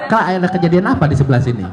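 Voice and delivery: usual speaking voice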